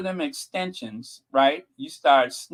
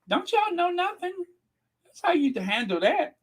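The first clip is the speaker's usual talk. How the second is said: scolding. Real, not acted. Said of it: "scolding audience".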